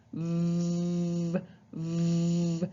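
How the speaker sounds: vvv with top teeth on lip; buzzing V. Shown as a demonstration. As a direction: buzz the V. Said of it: "top teeth on the bottom lip".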